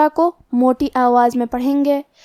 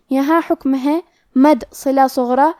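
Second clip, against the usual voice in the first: ordinary delivery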